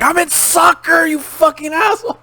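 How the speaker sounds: shouting at the guy; mock angry shout. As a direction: yell back in outrage. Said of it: "And I'm like".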